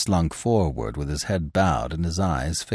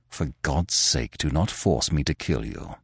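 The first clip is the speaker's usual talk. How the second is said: low looming threat; 5 semitones lower, 6 dB quieter than usual. A narrator's reading. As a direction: sink the voice, deadly quiet. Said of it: "his voice sank almost to a whisper".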